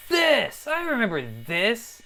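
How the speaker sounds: sudden high excited recognition. Real, not acted. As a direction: squeal of delighted recognition. Recognizing old program.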